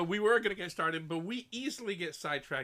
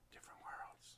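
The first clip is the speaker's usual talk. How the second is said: unvoiced whisper on different worlds; 20 dB quieter than usual. Shown as a demonstration. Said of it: "How was that?".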